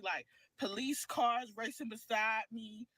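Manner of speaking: ordinary delivery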